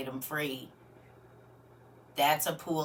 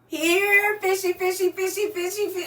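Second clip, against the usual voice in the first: high sing-song fishy call; calling the fish; 12 semitones higher, 5 dB louder than usual. Pretend. Host pretending to reel in viewers, high sing-song call. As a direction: coax the fish in, high and loud.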